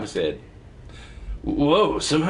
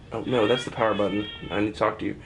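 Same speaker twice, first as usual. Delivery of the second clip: ordinary delivery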